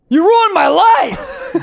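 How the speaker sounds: high, slow, laughing wail; mock dramatic shout. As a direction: cry it out, half laughing. Mock drama over TikTok comment.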